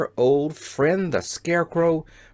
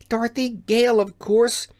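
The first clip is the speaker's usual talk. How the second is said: put-on eager girl; 8 semitones higher than usual. A narrator's reading. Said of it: "she answered".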